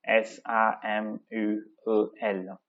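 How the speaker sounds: slow, letter by letter; each letter given separately; spelling out. Shown as a demonstration. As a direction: spell the surname out slowly. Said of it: "she spells out".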